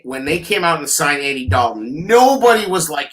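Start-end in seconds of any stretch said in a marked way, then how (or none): none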